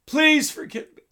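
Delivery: imitated cry of prayer; acted plea for mercy. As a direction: call out to God, pitch leaping up on the plea. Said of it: "he'd cry out to God".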